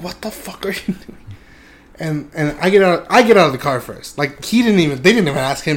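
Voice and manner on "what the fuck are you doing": breathless whispered alarm, softer; hissed whisper. Retold or imitated. Friend in the car whispering to narrator, panicked whisper. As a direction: whisper it so the cops won't hear. Friend reacts during police stop.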